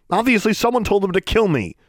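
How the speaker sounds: put-on high angry girl voice; indignant girl. A narrator's reading. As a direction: throw the blame at him. Alexandra accusing.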